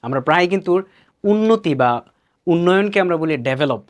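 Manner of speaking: usual speaking voice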